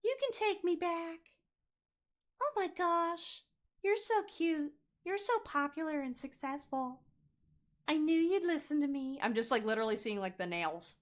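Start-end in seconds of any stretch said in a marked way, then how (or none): 0.0-9.2 higher, gushing flattering voice; mock the ex sucking up, pitched up; mock sweet-talker. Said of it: "you're gonna hear all the".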